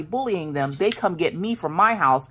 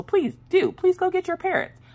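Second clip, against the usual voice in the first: mocking dare; 14 semitones higher than usual. Daring the teen, retold.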